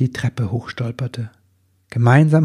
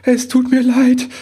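very high, louder, tearful-elf apology; apologetic little elf; 14 semitones higher, 5 dB louder than usual. A narrator's reading. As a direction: plead in a tiny high-pitched voice. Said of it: "begann der Wichtel erneut". (hohe, klägliche Wichtelstimme)

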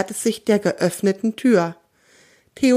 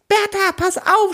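much higher urgent shout; warning shout; 11 semitones higher than usual. A narrator's reading. Theo warns Bertha about the fox, urgent high shout. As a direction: shout a warning across the room. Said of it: "schreit Theo".

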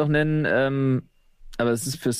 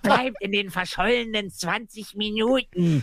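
mock sinister refusal; 6 semitones higher, slower than usual. Teasing dramatic refusal.

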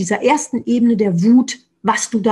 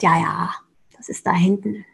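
softer, half-whispered brush-off; imitating her old self in a quiet, dismissive whisper; 4 semitones lower, 4 dB quieter than usual. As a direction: wave it away under the breath. Mocking her own earlier dismissal.

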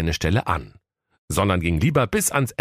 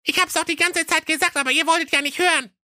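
squeaky high, fast I-told-you-so; high cartoon know-it-all; know-it-all little sister; faster than usual. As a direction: rattle it off high and smug. Youngest sister says I told you.

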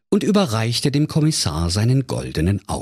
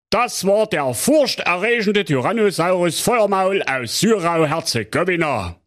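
Kommissar Bärbel Ehrlicher; 12 semitones higher than usual. Acted. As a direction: drag it out like a smug small-town cop. Commissioner sums up.

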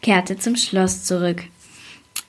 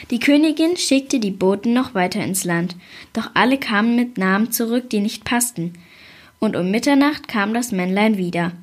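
usual speaking voice

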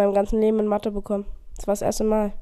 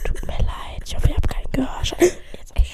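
whispered apology; whispering; 6 semitones higher than usual. Real, not acted. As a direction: whisper it like ASMR. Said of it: "A S M R".

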